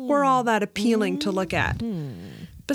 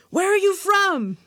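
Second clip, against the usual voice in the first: high, eager question; excited suitor; 7 semitones higher, 3 dB louder than usual. A narrator's reading. Excited suitor questioning the foreign customer, eager and high. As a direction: press her with keen, high-pitched curiosity.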